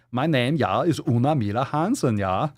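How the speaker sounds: lilting mock-Swedish introduction; artificial Swedish accent. Shown as a demonstration. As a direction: play a caricature Swede introducing herself. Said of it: "artificial Swedish accent or something".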